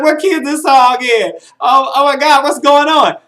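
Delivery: high, loud, mock-horrified outcry; exasperated disbelief. Reviewer reacting to a singer changing keys, exasperated disbelief. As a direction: cry out in comic dismay at bad singing.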